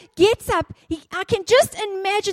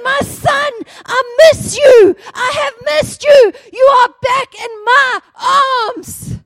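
higher, louder cry of a father embracing his son; overjoyed father; 6 semitones higher, 6 dB louder than usual. Retold or imitated. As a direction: shout it through tears of joy. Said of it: "and he says".

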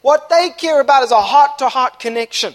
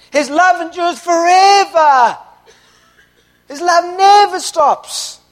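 higher, slow, shouted declaration; impassioned proclamation; 6 semitones higher, 4 dB louder, slower than usual. Real, not acted. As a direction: proclaim it to the back row. Preaching climax repeated.

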